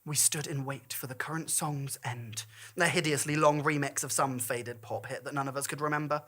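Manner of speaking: plain reading voice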